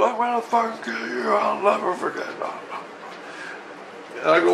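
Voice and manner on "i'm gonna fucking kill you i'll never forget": high, strained mock threat; hammed-up high-pitched yeller; imitated yelling. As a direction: rage in a high put-on voice without much volume. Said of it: "And he's yelling".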